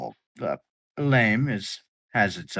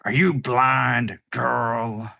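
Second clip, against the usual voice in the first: loud, slow, higher sneer; towering brute; 5 semitones higher, 7 dB louder, much slower than usual. Acted. Large pirate towering over the captain, loud slow sneer. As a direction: loom over her and sneer. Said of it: "towering over you and goes".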